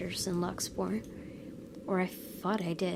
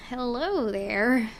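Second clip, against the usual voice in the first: creepy woman voice; 4 semitones higher, 6 dB louder, slower than usual. Acted. Creepy woman greets him.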